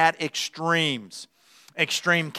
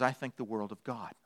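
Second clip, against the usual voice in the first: low, very soft, adoring dog; hushed voice of humble devotion; devoted dog; 6 semitones lower, 14 dB quieter than usual. Acted. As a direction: drop it low and reverent. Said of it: "And a dog says".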